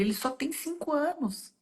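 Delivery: whispered aside, voice dropped away. Real, not acted. Mom to audience, hushed about her little son. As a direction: breathe it softly to the audience.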